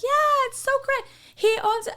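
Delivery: very high gushing imitation; mock enthusiasm. Staff praising their boss's place, high mock-enthusiastic voice. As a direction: squeal it like an oblivious fan. Mimics the oblivious young staff.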